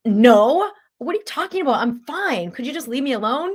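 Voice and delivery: a half-hammed insistence that she's fine; mock irritated denial.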